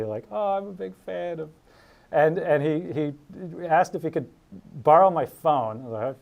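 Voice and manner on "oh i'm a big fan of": small, higher-pitched sheepish admission. Retold or imitated. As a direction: own up shyly to being a fan. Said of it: "you know, sheepishly".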